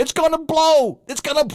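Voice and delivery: high, hammed-up warning; mock alarm. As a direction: shout that it will explode. Gas leaking from sailboat.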